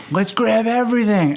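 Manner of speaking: high-pitched, excited outburst; eager dreamer. Acted. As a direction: play the big dreamer bursting with enthusiasm. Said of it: "I'm the dreamer. I'm like".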